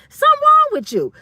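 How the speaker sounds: high, loud mock disbelief; high incredulous squeal. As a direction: shoot way up high on "wrong" and fall off. Mocking disbelief at a hater.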